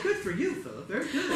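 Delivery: high-pitched motherly praise; imitating an encouraging mom; approving mother. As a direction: praise him in Mom's high voice.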